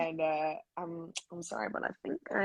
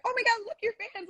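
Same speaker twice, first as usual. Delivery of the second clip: excited friends; faster than usual. Retold or imitated. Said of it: "Angel and Sky are like".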